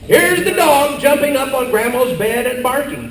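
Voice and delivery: usual speaking voice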